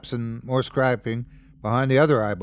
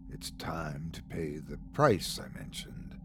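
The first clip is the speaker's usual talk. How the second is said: much softer, lower sinister whisper; a cold, menacing whisper; 7 semitones lower, 12 dB quieter than usual. A narrator's reading. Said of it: "and then whispered".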